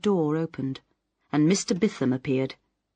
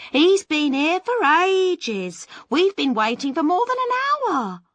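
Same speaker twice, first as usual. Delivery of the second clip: put-on chorus of girls; 13 semitones higher, 7 dB louder than usual. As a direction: sing it out like the waiting girls. Girls answer together.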